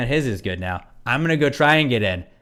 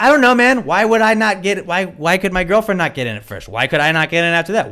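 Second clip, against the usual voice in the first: loud, high-pitched retold arguing; exasperated protest; 7 semitones higher, 5 dB louder than usual. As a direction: fire off incredulous questions, voice raised. Retold argument with ticket guy.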